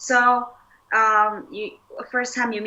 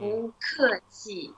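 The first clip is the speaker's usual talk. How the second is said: slower, softer repeat for a learner; slow model phrase; 6 dB quieter, slower than usual. Shown as a demonstration. Teacher corrects a learner's repeat, slow and measured. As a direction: draw out each syllable, a little softly.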